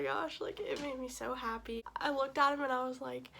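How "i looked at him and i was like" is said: usual speaking voice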